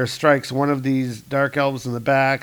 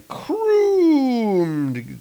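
long drawn-out high "croom"; comic sound effect; 12 semitones higher than usual. Acted. Narrator voicing Thor's punch, drawn-out sound effect. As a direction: stretch it out like a booming crash.

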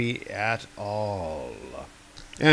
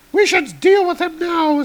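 much higher heroic woman; high woman's voice; 5 dB louder than usual. Acted. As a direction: lift the voice high for Girl Thor. Girl Thor voiced.

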